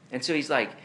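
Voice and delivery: ordinary talk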